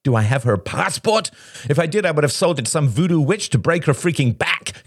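high-pitched, ranting outburst; indignant, overwrought raving; agitated rant. Acted. Shane ranting until told to calm down.

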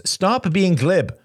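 high, insistent reproach. A narrator's reading. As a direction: push him, voice lifted high. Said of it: "Robert raised his voice".

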